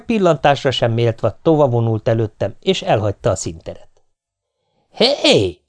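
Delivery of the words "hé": a high yelled hey. A narrator's reading. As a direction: bellow at her once more. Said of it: "ordítottam újból". (magas, hangos rákiáltás)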